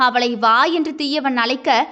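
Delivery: ordinary delivery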